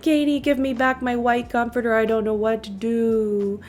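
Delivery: a hint of a whiny, pleading pet voice; voicing the sulky dog. Owner voicing her upset dog asking for his comforter, mildly whiny. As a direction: lightly play the dog begging for his blanket. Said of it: "and he's like".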